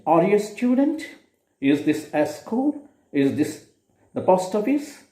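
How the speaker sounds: student, school and post office each rising as yes-or-no questions. Shown as a demonstration. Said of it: "rising tone if it is a yes or no question".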